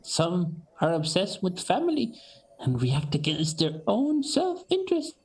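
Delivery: high, grave elder voice warning; old orc woman, solemn. Acted. Elder orc woman warning Fati about the orc flaw, high solemn voice. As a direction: counsel her gravely, like a grandmother.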